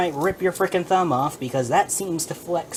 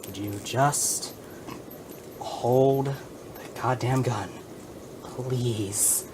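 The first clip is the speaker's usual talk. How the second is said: slow begging; 4 semitones lower, 5 dB quieter, much slower than usual. Real, not acted. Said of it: "I'm begging you at this point".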